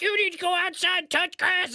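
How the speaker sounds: high-pitched mock scolding; mocking commenter voice. Streamer imagining the commenter in chat, mocking. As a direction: put on a high, silly voice to imitate a scold.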